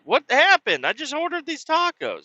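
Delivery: high, bewildered plea; innocent high protest. Retold or imitated. Son pleading with angry mom over tacos, high bewildered voice. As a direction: play dumb in a high voice. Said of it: "I'm like".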